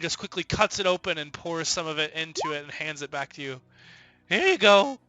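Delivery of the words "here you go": mushroom woman voice. Acted. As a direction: raise it into a kindly old hermit.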